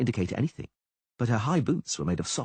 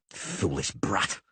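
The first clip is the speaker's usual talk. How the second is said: hissed furiously; 4 semitones lower, much slower than usual. A narrator's reading. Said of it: "he hissed furiously".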